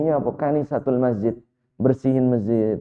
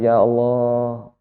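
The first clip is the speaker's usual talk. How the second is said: drawn-out mock lament; acting out a tearful old woman; imitated crying; 3 semitones lower, much slower than usual. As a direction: slowly wail it as a retold plea. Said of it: "Akhirnya nenek ini nangis".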